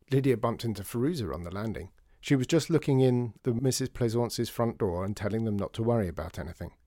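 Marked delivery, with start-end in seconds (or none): none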